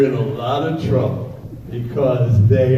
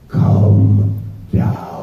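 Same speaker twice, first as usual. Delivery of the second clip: put-on much lower, eerie possessed child; creepy deep kid; 6 semitones lower, 5 dB louder than usual. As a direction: summon him like a horror-film child.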